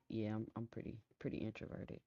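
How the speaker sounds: fading to a near whisper; trailing off to a whisper. Real, not acted. Streamer admits to chat he's introverted, fading into a whisper. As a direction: let it shrink away shyly. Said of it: "introverted personality".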